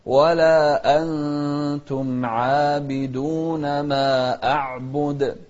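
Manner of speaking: slow melodic tajweed chant; Quran recitation. Shown as a demonstration. Teacher reciting Quran to students, slow melodic chant.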